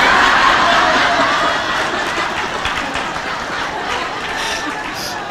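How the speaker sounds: long silent, airy wheeze of laughter; breathless laugh. Real, not acted. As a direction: laugh helplessly, almost no voice. Laughing at husband's license plate gift.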